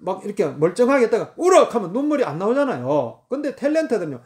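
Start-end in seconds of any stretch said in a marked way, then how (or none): 1.4-1.7 mild mock director's shout; shouted command. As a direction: punch out a sudden cue. Same director's cue acted again.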